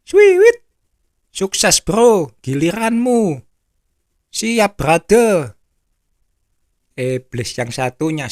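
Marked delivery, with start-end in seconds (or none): 0.1-0.6 high, loud whistled call; signal whistle. Acted. Devil signals his friend, high signal whistle. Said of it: "Dia kasih isyarat sama temennya". 1.3-5.6 higher, louder acted call-out; gleeful devils' hail. Devil hands his friend the next turn, gleeful call.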